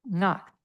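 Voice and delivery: "knock" said NGAHK, starting from ng; ngak. Shown as a demonstration. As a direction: model the nasal start softly. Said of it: "like N".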